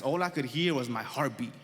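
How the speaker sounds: ordinary delivery, nothing marked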